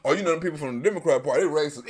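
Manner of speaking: low, fast mocking aside; quick low mocking voice; mock accusation. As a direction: rattle it off like a gossiping partisan. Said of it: "We don't even say that shit".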